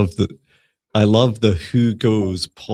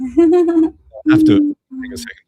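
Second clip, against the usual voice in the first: very high squeaky put-on voice; 7 dB louder than usual. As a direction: play a tiny high-pitched caller. Pretends to phone the boss.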